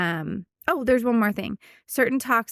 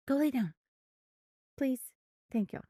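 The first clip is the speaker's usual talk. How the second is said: soft, raised-pitch aside to a dog; hushed pet command; 5 semitones higher, 8 dB quieter than usual. Real, not acted. Owner settling her dog mid-podcast, hushed coaxing. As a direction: gently send the dog away under the talk.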